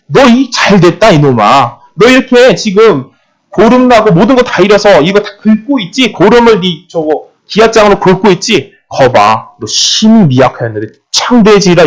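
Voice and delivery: high, mocking taunt at Job; mocking sneer. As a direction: jeer at the suffering man. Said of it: "이렇게 비웃고 있는".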